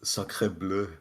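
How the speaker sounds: over-the-top French exclamation; French accent. Pretend. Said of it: "Is he French?".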